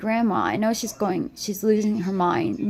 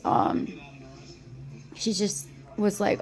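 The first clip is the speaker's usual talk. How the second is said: ordinary talk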